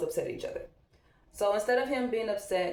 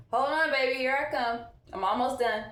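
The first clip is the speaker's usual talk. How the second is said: raised voice, louder and higher; calling out; 5 semitones higher, 4 dB louder than usual. Real, not acted. Calls to child off camera.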